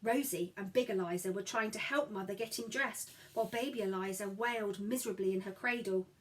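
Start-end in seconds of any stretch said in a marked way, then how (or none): none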